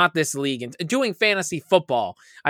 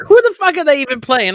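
higher, louder exasperated demand; mock furious; 9 semitones higher, 7 dB louder than usual. Recalling his matchup, a mock furious outburst. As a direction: blurt it out in disbelief. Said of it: "I'm like".

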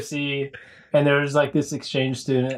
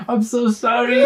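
sheepish apology; 8 semitones higher than usual. Retold or imitated. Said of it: "he's like".

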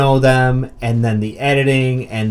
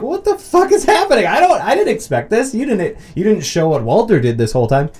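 mock bewildered viewer; 9 semitones higher, 3 dB louder than usual.

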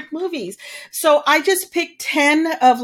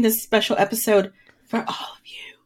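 breathy low whisper, playfully sultry; mock seductive; 5 semitones lower, 5 dB quieter, faster than usual. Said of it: "Do I sound sexy?".

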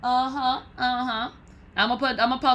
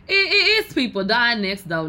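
louder, much higher punch on the quote; emphatic quote; 3 semitones higher, 6 dB louder than usual. Retold or imitated. Repeating the rapper's line to viewers for emphasis, louder and higher. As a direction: hit the line hard the second time. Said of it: "Y'all remember when [name] said that?".

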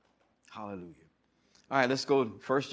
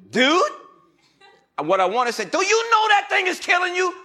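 high, loud confronting shout; imagined telling-off at the takeout bar, shouting; imagined angry retort; 14 semitones higher, 8 dB louder than usual. Retold or imitated. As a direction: tell him off hard. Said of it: "I was gonna say".

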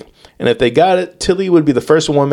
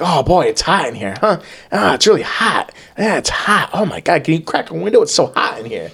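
bored complaining; 4 semitones higher than usual. Acted. Bored defendant grumbling about a hot courtroom, mock complaining. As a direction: act out someone underwhelmed and grumbling. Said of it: "Tilly appeared bored and underwhelmed".

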